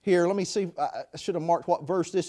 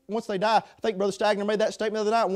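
ordinary talk

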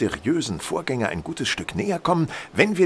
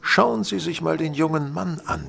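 ordinary delivery

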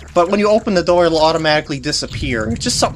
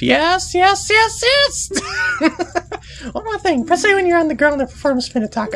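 high, indignant fairy scolding; bossy fairy. Acted. Tatl blaming Deku boy for losing her brother, high and indignant. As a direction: snap at him like a bratty little fairy.

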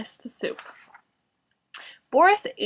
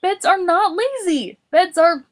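high, indignant raised voice; Boris; 9 semitones higher, 5 dB louder than usual. A narrator's reading. Boris defending beds to Morris, shouting. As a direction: protest loudly, pitched well up. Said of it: "Boris shouted".

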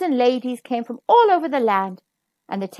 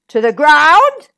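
loud, slow incredulous bellow; king bellowing at the barber; 2 semitones higher, 11 dB louder, slower than usual. Acted. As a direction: bellow it back in disbelief. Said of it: "bellowed the king".

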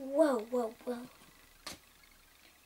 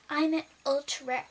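ordinary talk

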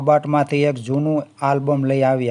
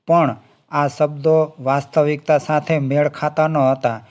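usual speaking voice

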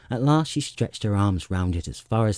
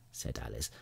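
ordinary delivery, nothing marked

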